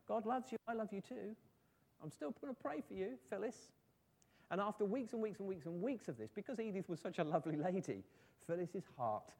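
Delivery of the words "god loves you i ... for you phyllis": high, sweet smiling voice; smiling, loving reassurance. A narrator's reading. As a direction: say it with a smile, pitched up. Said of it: "Edith would smile and say".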